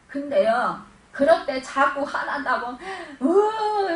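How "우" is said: mock angry whimper. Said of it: "이러면서 막 그냥 화를 내고".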